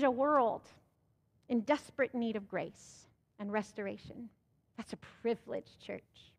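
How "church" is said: ordinary talk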